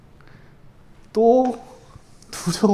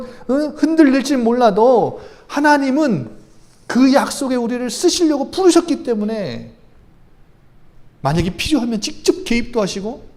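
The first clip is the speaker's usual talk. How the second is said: louder, rising preacher's voice, driving the point home; fervent preaching; 6 semitones higher, 7 dB louder than usual. Acted. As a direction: preach it out with full conviction. Preaching at full force.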